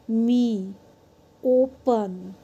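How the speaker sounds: "मी" and "ओपन" apart, open vowels held; long vowels. Shown as a demonstration. Teacher giving examples of long sounds, "मी, ओपन" with drawn-out vowels. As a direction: stretch the ee of "मी" and O of "ओपन". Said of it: "वो long sound होगी".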